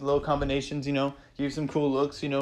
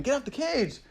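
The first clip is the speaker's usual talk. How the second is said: higher-pitched mock yell at the TV; retold yell at screen; 10 semitones higher, 3 dB quieter than usual. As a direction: urge the fighter off the cage, pitched up. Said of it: "I was yelling at my screen".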